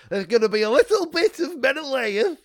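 booming mock heroic teaser; Menelaus voice tease. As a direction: announce it like a booming hero. Teasing a character voice coming.

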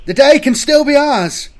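high, loud heroic shout; rallying battle cry. A narrator's reading. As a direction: shout hope to embattled troops. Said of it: "Velas cried".

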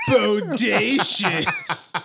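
hammy leering shout. Pretend. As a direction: ham up an admiring yell at the car. Voicing a neighbor ogling.

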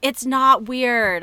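slow, loud, dismissive voice; Stephanie Meyer. Retold or imitated. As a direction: drag it out, brushing off a concern. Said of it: "Stephanie Meyer's like".

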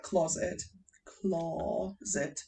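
"closet" slowly, opening up the first vowel; shown vowel sound. Shown as a demonstration. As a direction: demonstrate the vowel in "closet", drawing it out softly. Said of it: "it's an or sound".

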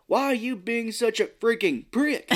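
high, loud mock dubbed line; bad TV voiceover. Joking about censored movie dubs, hammed-up voiceover voice. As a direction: ham it like a clumsy TV dub. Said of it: "He does that so good".

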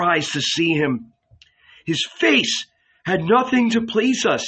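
Matthew proclaims Isaiah to steady their hearts; strong prophetic voice. Acted. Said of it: "full of bitterness and strength".

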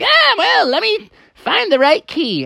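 high, odd character voice; weasel janitor. Acted. Weasel janitor questions the helpers and the trapped frog, high-voiced. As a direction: play a weasel janitor sizing things up. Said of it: "the weasel was like".

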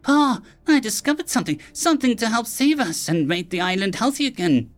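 put-on high, eager boy's call; excited cry. A narrator's reading. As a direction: burst out with the news. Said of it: "Vadelin cried out".

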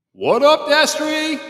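high, loud hype shout; hype greeting. Acted. Host greeting a guest on stream, loud hype shout. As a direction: greet him like a hype man. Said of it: "I had to do it".

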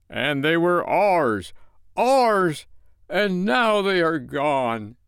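high, slow, swelling lament; drawn-out, impassioned grief; grieving mother. A narrator's reading. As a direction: cry out in anguish over the loss. Parents grieve their lost children.

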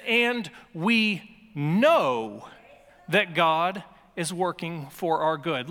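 know drawn out slowly; stress on know. Shown as a demonstration. Preacher to congregation, drawn-out know. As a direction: lean hard on know. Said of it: "This is an important word".

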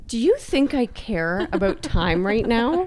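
slow, whiny mock tantrum; angry inner child. Exhausted runner snapping at partner's talk of finish times, childish mock anger. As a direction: play up a petulant child's indignation. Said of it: "my inner child was coming out".

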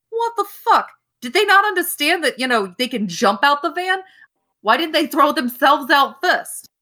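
furious mechanic ranting about his car; outraged yelling. Acted. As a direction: explode at the ruined back seat. Mechanic outraged at wrecked seat.

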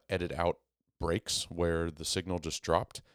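usual speaking voice

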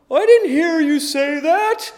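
high, loud, drawn-out mock kid voice; mimicked kid's excuse. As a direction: whine it slowly like a child making excuses. Mimicking his sons' excuse.

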